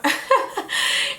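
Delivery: breathy giggle up high; high airy laugh. Real, not acted. Laughing at the season one callback.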